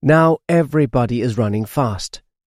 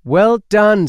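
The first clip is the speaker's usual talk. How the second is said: cheering; 9 semitones higher, 6 dB louder than usual. A narrator's reading. Crowd cheering Sniffy.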